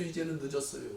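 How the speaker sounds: resigned soft echo; quiet echo. Shown as a demonstration. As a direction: let 이제는 늦었어요 fall away softly.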